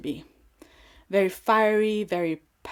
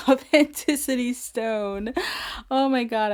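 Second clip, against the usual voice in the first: real laugh breaking into the words; 3 semitones higher, 6 dB louder than usual. Real, not acted. Reader amused by the wild energy of the reading, laughing. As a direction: talk through a rising giggle. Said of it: "I'm laughing".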